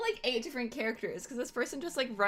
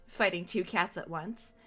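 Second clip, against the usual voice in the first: ordinary delivery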